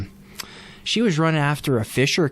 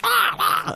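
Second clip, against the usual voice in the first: very high shrieking "ah, ah"; imitated animal scream. Friend describes the fisher cat's cry, very high imitated shriek. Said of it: "it fucking screams".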